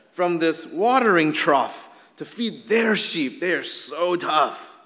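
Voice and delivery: slow, drawn-out mock admiration; mock the mighty shepherds with heavy emphasis; sarcastic awe, building in force. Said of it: "a sarcastic one in saying".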